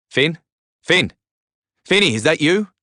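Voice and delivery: high, urgent searching call. A narrator's reading. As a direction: call for a friend trapped in wreckage. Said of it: "my voice called to Finn".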